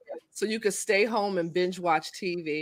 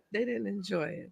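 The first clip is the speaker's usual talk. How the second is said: ordinary talk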